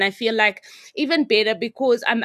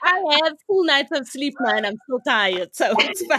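laughing; 6 semitones higher than usual. Real, not acted. Laughing self-deprecation.

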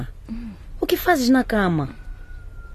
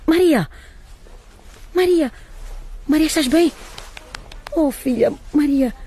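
calling out in panic; 7 semitones higher than usual. Acted. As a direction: shout for the fainted daughter, panicking. Mother finds daughter collapsed. (chamando por ela em pânico, alto e agudo)

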